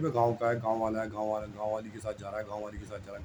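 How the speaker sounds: low, hushed muttering that fades away; muttered gossip. Retold or imitated. Onlookers remarking on the villager, low fading mutter. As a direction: mutter it under your breath like onlookers.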